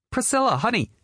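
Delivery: high calling mother voice. Acted. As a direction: call out sweetly to her daughter.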